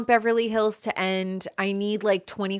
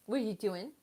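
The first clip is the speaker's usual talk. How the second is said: much softer, tender question to the pet; 12 dB quieter, faster than usual. Real, not acted. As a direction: murmur it to the pet. Soft baby talk to pet.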